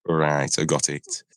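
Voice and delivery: ordinary delivery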